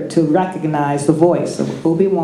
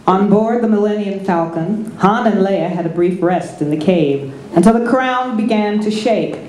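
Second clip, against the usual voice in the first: ordinary delivery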